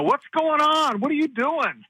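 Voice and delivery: high-pitched yell, no great volume; mock yelling. Manager re-enacts his recorded hotline calls, mock yelling. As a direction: re-enact an agitated hotline rant. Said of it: "calling into the hotline and yelling at".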